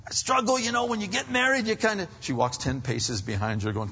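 normal talking voice

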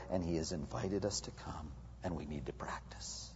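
hushed whisper; whispered urging. Real, not acted. Preacher whispering the call to practice prayer. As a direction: lean in and whisper it.

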